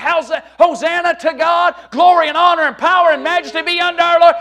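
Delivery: high, loud cry of worship; shouted praise. Acted. Preacher voices heaven's crowd praising God, shouting. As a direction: ring it out like a heavenly multitude. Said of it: "and they're shouting".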